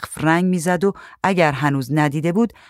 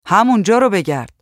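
raised, slightly higher calling voice; shouted reply; 5 semitones higher, 5 dB louder than usual. A narrator's reading. Man answers wife about bug spray, mild shout. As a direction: call it back across the rooms. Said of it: "مرد داد زد".

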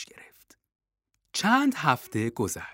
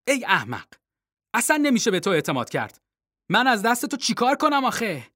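scolding mother; 6 semitones higher, faster than usual. Acted. Mother scolds foolish son.

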